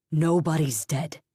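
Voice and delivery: slow, steady reassurance; a touch of firm husband calming his wife. Acted. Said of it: "he said firmly".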